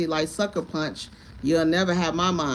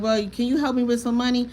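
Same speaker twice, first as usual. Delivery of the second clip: pleading for money; 6 semitones higher than usual. Retold or imitated. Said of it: "I'm like".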